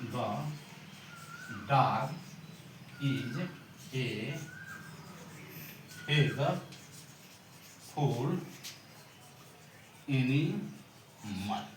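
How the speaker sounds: each English word set apart, slowly and deliberately; spaced-out classroom dictation. Shown as a demonstration. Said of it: "dictating English translation slowly".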